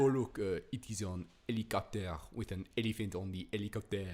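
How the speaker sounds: dropping the H, hammed-up French English; French accent. Shown as a demonstration. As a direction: play a tourist in a comic French accent. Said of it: "if you talk like this a bit".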